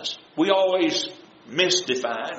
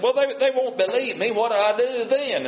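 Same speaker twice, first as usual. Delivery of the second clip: higher-pitched, stammered objection; mild mock protest; 8 semitones higher than usual. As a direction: lightly voice a reluctant excuse-maker, pitched up. Acting out Moses making excuses.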